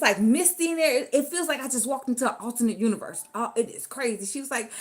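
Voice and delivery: ordinary talk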